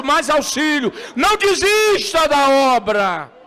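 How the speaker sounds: loud, high shouted preaching; fiery pulpit cry over music; full-force preaching. Acted.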